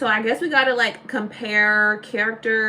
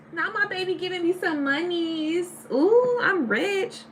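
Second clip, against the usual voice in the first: playful excited; 9 semitones higher than usual. Real, not acted. Thrilled at money gift from Yako.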